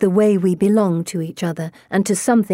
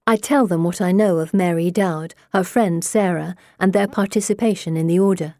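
usual speaking voice

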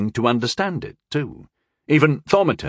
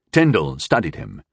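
ordinary delivery

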